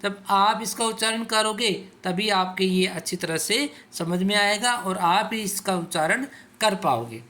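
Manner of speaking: ordinary delivery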